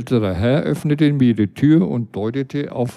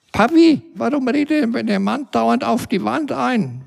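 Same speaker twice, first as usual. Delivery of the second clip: put-on very high, piping child's question; a little kid asking daddy, wide-eyed; 10 semitones higher than usual. Small child asks his father about the man, very high voice. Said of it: "fragte ein Kind seinen Vater".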